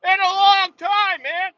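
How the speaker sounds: loud, very high, drawn-out holler; hammed-up slow shout; exaggerated yelling. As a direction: stretch it out and yell it high. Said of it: "I don't know why I'm yelling".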